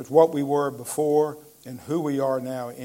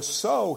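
"so" pitched high and singled out; stress on so; 7 semitones higher than usual. Shown as a demonstration. Preacher singles out the word "so" for the congregation. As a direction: lift the word "so" out on its own. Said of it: "this phrase, the".

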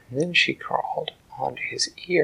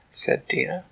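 plain reading voice

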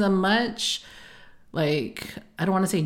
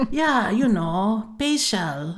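slow lightly voiced dad; imitating dad; 3 semitones higher, much slower than usual. Daughter imitating her dad explaining facials, slow. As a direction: stretch it out slowly like her dad. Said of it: "And then he goes".

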